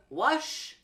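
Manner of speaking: Wash held on a long, smooth sh; wash with soft sh. Shown as a demonstration. Teacher contrasts Wash and watch, long sh. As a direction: let the sh in Wash flow out slowly. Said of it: "Can you say both?".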